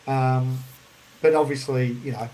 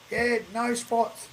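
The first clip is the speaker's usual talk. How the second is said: slow, higher mock call; slow higher imitation of a stranger; gruff bloke; 9 semitones higher, much slower than usual. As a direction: drag out the bloke's reply. Bloke answering, drawn out.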